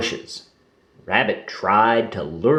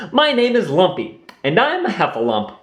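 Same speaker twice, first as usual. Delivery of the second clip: little Heffalump; 7 semitones higher, faster than usual. A narrator's reading. Lumpy introduces himself.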